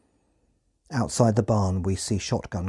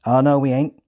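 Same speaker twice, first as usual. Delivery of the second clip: louder Hampshire farmer voice; Hampshire accent; 4 semitones higher, 6 dB louder than usual. Retold or imitated. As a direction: rural Hampshire denial. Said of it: "in a thick Hampshire accent".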